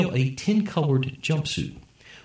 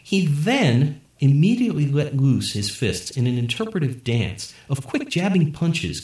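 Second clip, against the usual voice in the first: plain reading voice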